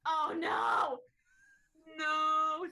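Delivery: loud, high cry of horror; horrified yelp. Real, not acted. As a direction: yelp in shock. Horrified at the question.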